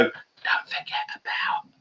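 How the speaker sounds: unvoiced stage whisper from behind the camera; imitating a camera operator's hushed whisper. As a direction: whisper it so nobody notices you. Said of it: "the person behind the camera doesn't want to be noticed".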